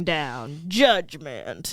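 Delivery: low, lightly voiced doom-preacher; a hint of gruff Bible-thumping. Bus preacher warning of fire and judgement, lightly gruff. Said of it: "this guy with the gruff voice".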